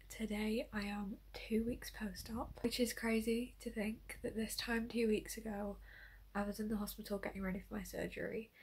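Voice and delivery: hushed early morning whisper. Real, not acted. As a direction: keep it to a whisper.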